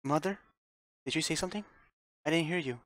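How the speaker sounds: a lightly voiced frightened daughter; anxious girl. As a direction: strain to hear Mother's voice. Rosa listening for Mother.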